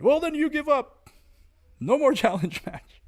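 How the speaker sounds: high, loud mocking voice of an elder; hammed-up imitation of a taunting elder. Mocking an old-timer's dare, high and loud.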